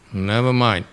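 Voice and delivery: normal talking voice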